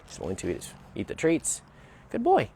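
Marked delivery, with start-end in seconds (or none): 2.1-2.5 high praise. Real, not acted. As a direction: gush praise at the dog. Praising the dog.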